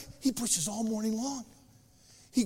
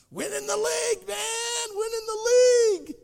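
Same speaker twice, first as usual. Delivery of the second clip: excited shout; 13 semitones higher, 6 dB louder than usual. Retold or imitated. Imagined player's goal, shouted.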